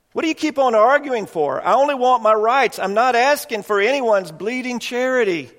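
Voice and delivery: a proud ghost protesting his rank below a murderer; indignant ghost. Acted. Ghost rejects charity.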